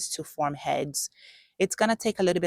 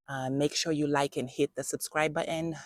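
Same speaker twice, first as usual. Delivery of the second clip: ordinary delivery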